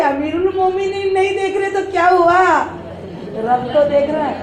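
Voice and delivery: storyteller acting a daughter's challenge; daughter's answer. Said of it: "تو جواب آیا".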